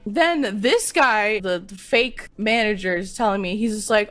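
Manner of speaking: ordinary delivery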